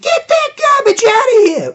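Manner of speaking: high, loud commentator catchphrase; Jack Armstrong. Retold or imitated. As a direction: shout it way up high like a broadcaster's signature call. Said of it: "he was doing his classic".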